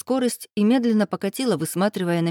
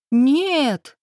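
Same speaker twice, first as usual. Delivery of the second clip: higher, louder protesting refusal; indignant children; 7 semitones higher, 5 dB louder than usual. A narrator's reading. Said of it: "дружно возмутились кузины".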